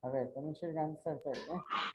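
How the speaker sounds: usual speaking voice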